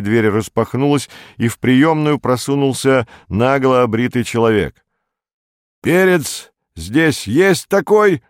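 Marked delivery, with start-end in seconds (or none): 5.8-8.2 high, stretched-out call; booming. A narrator's reading. Shaven man asks for Perets in reception, booming. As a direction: call it out slowly across the room. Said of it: "зычно осведомился он".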